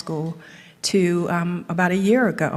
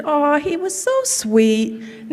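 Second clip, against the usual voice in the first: gushing daughter; 9 semitones higher, slower than usual. Retold or imitated. Daughter quoted gushing about Nat.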